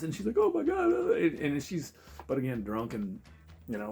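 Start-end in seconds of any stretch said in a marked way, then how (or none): 0.4-0.9 very high mock gasp of alarm, loud; shocked. Girl hearing phones were stolen, mock shock. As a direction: imitate her shock, pitched way up.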